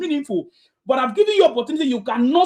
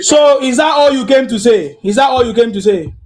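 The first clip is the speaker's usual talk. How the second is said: angry shout; 8 dB louder than usual. Real, not acted. Host cutting off a speaker who said nothing, shouting angrily. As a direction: shout him down, fed up with his answer.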